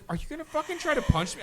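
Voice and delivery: high, rapid, incredulous question; alarmed disbelief. Retold or imitated. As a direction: retell it with startled disbelief. Retells facing a raised fist.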